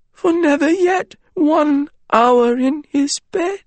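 very high, slow, broken voice; broken stammering. Acted. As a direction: stammer it out in anguish. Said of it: "Wren's voice came stammering out again, broken and disjointed".

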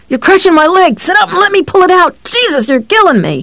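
high, fast panicked cry; pained yelling. A narrator's reading. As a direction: yell out in pain and panic. Johnny in pain yelling.

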